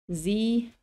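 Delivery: ordinary delivery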